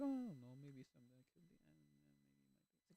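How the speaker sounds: soft mumble fading to almost nothing; noncommittal waffler. Acted. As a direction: dither quietly and let it die away. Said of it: "Rather than just being like".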